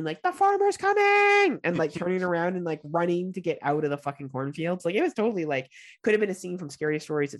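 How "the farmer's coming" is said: high, loud, drawn-out warning yell; retold yell. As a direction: holler it out like a kid raising the alarm. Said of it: "I remember yelling".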